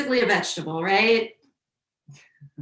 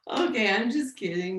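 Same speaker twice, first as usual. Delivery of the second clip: usual speaking voice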